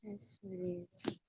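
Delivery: soft, slow cooing to the cats; cooing to pets. Real, not acted. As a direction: hush it down for sleeping kittens. Cooing to her sleeping cats.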